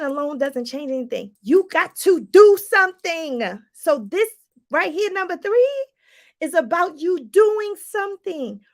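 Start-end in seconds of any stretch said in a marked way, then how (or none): 1.4-3.6 forceful push. Real, not acted. Coaching push.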